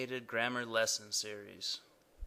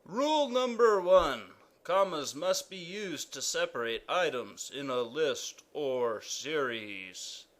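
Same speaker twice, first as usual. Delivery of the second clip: royal decree. Acted. King announces the first comma rule, proclaiming.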